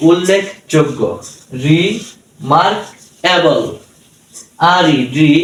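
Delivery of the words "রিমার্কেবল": রিমার্কেবল slowly, in three separate pieces; slow teaching demo of রিমার্কেবল, piece by piece; split into syllables. Shown as a demonstration. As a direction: pause between রি, mark and এবল in রিমার্কেবল.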